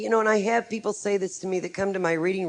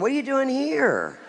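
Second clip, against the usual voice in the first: higher-pitched astonished question; mock surprise; 6 semitones higher than usual. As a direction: imitate a nosy stranger's surprise. Retold airport stranger surprised to see her.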